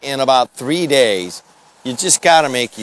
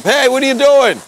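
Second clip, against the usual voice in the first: much higher hail across the street; calling out; 11 semitones higher, 3 dB louder than usual. Real, not acted. Host hailing a passerby from outdoors, loud call. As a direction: yell a friendly question to someone outside.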